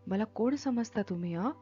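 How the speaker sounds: ordinary delivery, nothing marked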